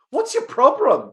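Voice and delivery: high, loud indignant shriek; shrieking little girl. Acted. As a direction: yell up at him, outraged. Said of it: "the little girl shrieked at Larry".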